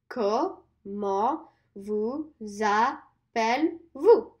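"Comment vous appelez-vous" very slow, falling end; slow repeat-after-me; slow full phrase. Shown as a demonstration. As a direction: say the whole question slowly. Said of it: "Let's do that one more time".

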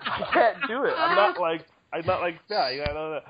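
normal talking voice

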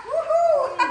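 gleeful cheer and laugh; excited whoop then laugh. Real, not acted.

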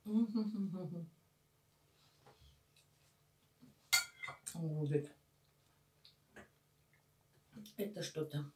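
speaking between spoonfuls of soup; chewing while talking; talking while eating. Real, not acted. Seen 2: Spoon of soup repeatedly at mouth, jaw working while she talks.

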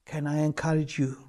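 usual speaking voice

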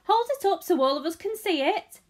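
high, loud onlooker; shouting. A narrator's reading. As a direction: call out eagerly over a crowd. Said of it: "somebody shouted".

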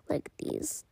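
soft unvoiced whisper. Real, not acted. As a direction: breathe it quietly. Whispered aside in play.

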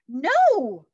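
high, loud alarmed cry; cried out. Acted. Morgan Le Fay stopping Annie, crying out. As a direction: cry out to stop her. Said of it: "cried Morgan Le Fay".

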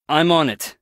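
ordinary delivery

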